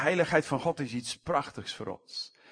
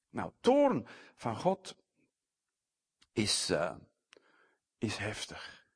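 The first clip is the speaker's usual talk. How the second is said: ordinary delivery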